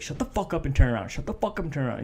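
normal talking voice

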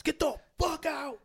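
high yelled get out; shouting. Retold or imitated. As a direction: yell them out the door. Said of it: "kicked out of the house".

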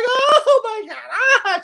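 very high, squealing mock laughter; imitated laughing. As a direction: imitate a lady cracking up at a joke. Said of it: "She's laughing".

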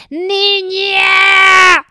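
calling out loud and high; shouted call. Acted. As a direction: shout her name across the distance. Tree calls out to unhearing woman.

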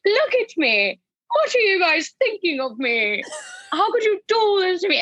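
hammed-up prophetess of doom; scorned oracle. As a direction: wail it like a scorned medieval oracle. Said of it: "she talks like some scorned oracle from, like, the twelfth century".

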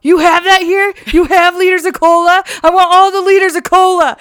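high, loud, excited "You have that here?" rising; mock Farva. Retelling Farva ordering cola, loud high excited mock voice. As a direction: squeal it like a greedy, overexcited customer.

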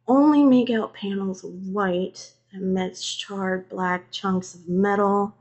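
usual speaking voice

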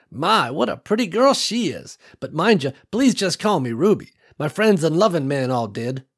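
put-on high, cheerful old matriarch welcoming guests; old farm woman welcoming strangers, cheerful and high; kindly old woman. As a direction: beam at them like a proud grandma.

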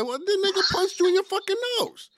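high, fast incredulous retort; exasperated outburst. Retold or imitated. To his girlfriend defending the fight, a high fast exasperated outburst. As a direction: fire it back in disbelief.

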